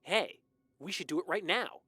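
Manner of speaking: put-on excited boy pitching an idea. A narrator's reading. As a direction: pipe up with a gleam of excitement. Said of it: "a gleam of excitement in his eyes".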